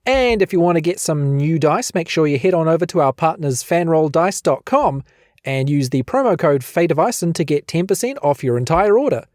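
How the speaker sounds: ordinary talk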